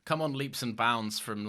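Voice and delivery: usual speaking voice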